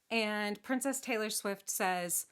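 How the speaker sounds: ordinary delivery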